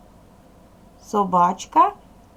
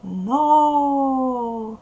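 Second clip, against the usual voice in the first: long, stretched call to a horse, louder and higher; протяжне «Но, но»; drawn-out giddy-up; 5 semitones higher, 4 dB louder than usual. Shown as a demonstration. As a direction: draw out each "Но" like urging a pony on. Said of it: "протяжно".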